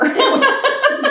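high bursting laugh; real high laugh; burst of laughter. Real, not acted. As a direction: laugh out loud at the joke. Laughing at a two-parter joke.

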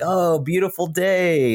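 high, slow sing-song delight; sweetly exaggerated morning cheer; mock cheerful. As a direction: ham up a blissful morning greeting. Cheery morning before painful step.

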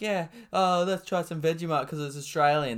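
put-on a cheesy American accent, poking fun; mock American accent. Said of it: "how Americans are like".

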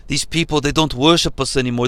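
mock Italian accent, low and quick; Zeus in a hammed-up Italian accent; a lightly put-on Italian accent. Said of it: "This is me doing the Italian accent".

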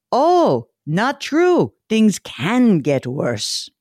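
mock Bulgarian optimist, high Oh then slow punchline; Bulgarian accent. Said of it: "I'll do it with his little accent".

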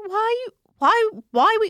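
high, loud mock bewildered son; a hammed-up puzzled teenage son; imitating her son. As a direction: squeak out his confused question. Mother voicing son's confused question.

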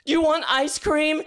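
sudden high-pitched panicky offer; blurted out. Retold or imitated. Uncle dodging a five-year-old's "are you sad", high sudden blurt. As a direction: blurt it out, voice leaping way up. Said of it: "And I just blurted".

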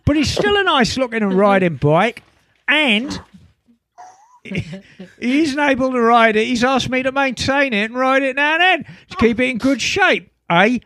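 hammed-up Essex accent. Reading Chris from Essex's email aloud, put-on Essex accent. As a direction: read it out like an Essex bloke. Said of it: "Can you do that accent".

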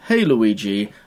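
low, lifeless line reading; Magic Johnson phoning it in. Retold or imitated. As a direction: deliver it with no performance at all. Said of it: "no performance, no delivery, nothing".

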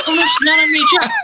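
a rushed, high-pitched oh no; panicked denial. Retold or imitated. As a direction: blurt it out in a panicked squeak. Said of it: "We were like".

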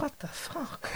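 retold whispered swearing, very soft; hushed aside of disbelief; exasperated whisper. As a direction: mouth it in a voiceless, disbelieving whisper. Retold exasperated reaction to waiting.

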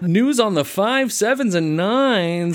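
bright, high radio announcer; morning radio host. Retold or imitated. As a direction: read it like a 7 AM local DJ. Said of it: "local morning radio show".

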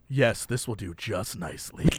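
low, soft creepy purr. Retold or imitated. Quarterback in the mattress ad, creepy low purr. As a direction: savor it like a creep. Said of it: "creepiest way possible".

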